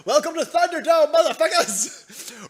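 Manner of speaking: high, loud hammed-up welcome; mock-epic shouted welcome; mock arena announcer. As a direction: bellow it to a crowd, stretching the end. Mock arena announcement of cat fight.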